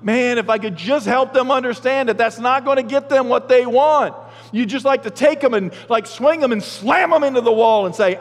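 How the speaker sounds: high, loud mock parent voice; frustrated parent. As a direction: ham up a fed-up parent. Preacher voicing frustrated parent.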